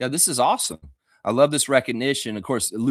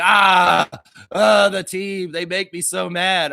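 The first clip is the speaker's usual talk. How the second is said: louder, higher whiny complaint of a sour fan; grumpy fan impression; 6 semitones higher, 6 dB louder, slower than usual. Mocking negative Raptors fans who complain, whiny loud grumble. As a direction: play a moaning negative fan. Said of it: "some sort of fan that's just like".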